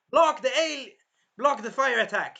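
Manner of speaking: loud, high battle call; shouted command. Acted. Naofumi shouting to block the fire attack, loud. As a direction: call the block out over the fight.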